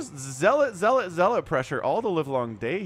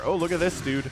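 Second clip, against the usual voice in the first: usual speaking voice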